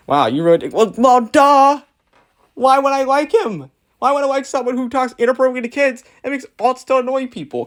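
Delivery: high-pitched, incredulous "duh"; scornful disbelief. Real, not acted. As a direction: climb high in mocking disbelief. Mock outrage at the commenter's question.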